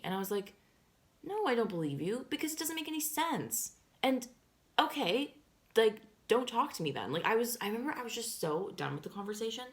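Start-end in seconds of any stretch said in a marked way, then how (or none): 1.2-7.1 high, exasperated retort; re-enacted argument. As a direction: retell a phone row, pitch jumping up. Re-enacts phone quarrel with lying friend.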